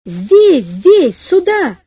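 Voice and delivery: loud, urgent hailing shouts; excited loud calls, swooping in pitch; flustered calling. A narrator's reading. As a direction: shout to be found, each word punched. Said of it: "засуетился Иван Гермогеныч".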